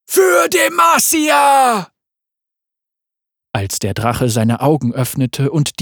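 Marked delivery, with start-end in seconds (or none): 0.0-1.9 drawn-out high battle yell; war cry. A narrator's reading. Soldiers' rallying cry as they storm the dragon, slow high yell. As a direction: stretch the cry over the charge.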